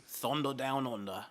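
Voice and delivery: slow, soft attempt at Australian, slightly off; Australian accent slipping into bad British tough guy. Joking with friends about film titles, bad Australian accent. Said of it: "I was trying to do Australian".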